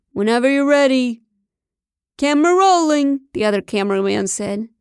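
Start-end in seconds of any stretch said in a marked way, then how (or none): 0.1-1.2 shouted call. A narrator's reading. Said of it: "went back to his camera and shouted". 2.2-3.2 calling out. A narrator's reading. Film crew calling out before a take.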